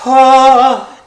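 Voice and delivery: loud, high theatrical gasp; shocked gasp. Acted. Listener hearing of a skull on stick, dramatic gasp.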